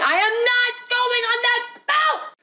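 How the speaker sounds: put-on high-pitched wailing kid refusing; child's tantrum. Small child refusing the boat in a tantrum. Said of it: "throwing a full-on tantrum saying".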